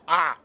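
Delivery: sharp high yelp of surprise; startled yelp. Shown as a demonstration. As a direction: yelp as if hit in the temple. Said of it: "I just go".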